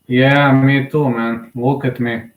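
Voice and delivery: ordinary talk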